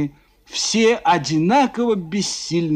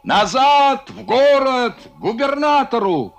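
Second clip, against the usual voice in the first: higher, louder shouted orders; 8 semitones higher, 3 dB louder than usual. Acted. Kononov yells orders to the captain, shouting. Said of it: "Кононов кричал капитану".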